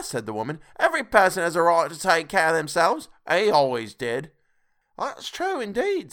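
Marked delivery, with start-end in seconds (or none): 0.8-4.3 high, loud character voice; defiant charwoman. A narrator's reading. Charwoman defending taking care of oneself, defiant. Woman defiantly justifies herself. 5.0-6.1 high-pitched laundress voice; laundress agreeing. A narrator's reading. Laundress backing up the bold charwoman, high-voiced agreement. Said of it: "said the laundress".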